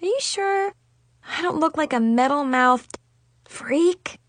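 high, small, insecure question; hurt, high-pitched self-doubt; sniffling. A narrator's reading. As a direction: plead for reassurance in a high little voice. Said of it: "Chloe sniffed".

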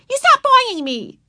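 put-on high, loud outraged girl; angry little girl. A narrator's reading. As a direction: squeal it like an offended child. Susan still shrieking at Ramona.

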